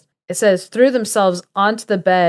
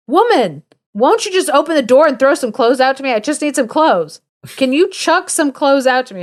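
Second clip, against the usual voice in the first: higher, insistent acted demand; exasperated calling out; 6 semitones higher, 3 dB louder than usual. John at the window begging for clothes, exasperated calling out. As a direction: call out, pleading and fed up.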